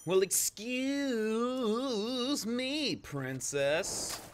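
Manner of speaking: high, loud, very slow yell; drawn-out shout. Pretend. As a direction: stretch every word, shouting. Famous meme quote, shouted.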